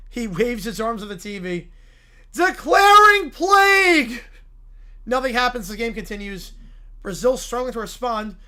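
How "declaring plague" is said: loud, anguished preacher's cry; a wounded zealot shouting at the sky; outraged preacher. Acted. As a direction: howl it like your church just fell. Character curse.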